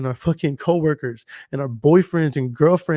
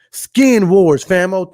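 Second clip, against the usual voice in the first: excited outburst; 4 semitones higher, 8 dB louder, much slower than usual. Real, not acted. Remembers the show's name.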